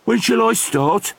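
high-pitched little troll voice; eager troll. Acted. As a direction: ask in a small, high voice. Troll asks for his job.

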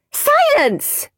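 very high commanding bellow. Acted. Teacher silencing noisy class, commanding bellow. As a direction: cut the whole class off. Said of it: "bellowed Miss Battleaxe".

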